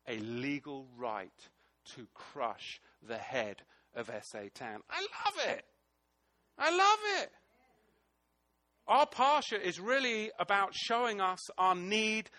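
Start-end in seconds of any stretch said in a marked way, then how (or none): 4.9-7.3 high, rising cry of joy; delighted exclamation. Real, not acted. Preacher exclaiming joy to the congregation, high and excited. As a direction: burst out loving the passage.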